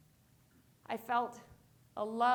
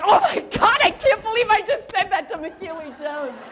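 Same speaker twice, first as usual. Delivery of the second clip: higher and louder, giddy disbelief; excited; 5 semitones higher, 17 dB louder than usual. Retold or imitated. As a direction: gush in disbelief at your own nerve. Shocked at her own words.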